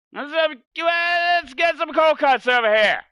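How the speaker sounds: high, loud New Jersey cold cut call; New Jersey accent. Retold or imitated. Mocking the Jersey guy calling for cold cuts, accented. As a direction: bellow it like a Jersey deli guy. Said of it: "the accent".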